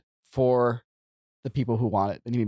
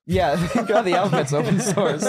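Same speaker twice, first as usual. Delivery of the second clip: laughing through the words; 9 semitones higher, 5 dB louder than usual. Real, not acted. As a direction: repeat the joke while cracking up. Laughs repeating a joke.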